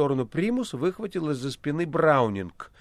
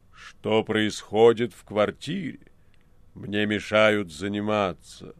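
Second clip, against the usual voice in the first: low, slow, heavy voice; a grave voice from nowhere; 7 semitones lower, slower than usual. Acted. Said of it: "тяжёлый низкий голос".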